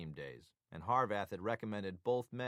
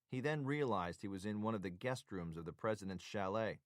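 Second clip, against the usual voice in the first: plain reading voice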